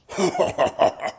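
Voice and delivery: high forced chuckle; acted laugh. A narrator's reading. Man covering his suspicion, laughing. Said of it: "He laughed".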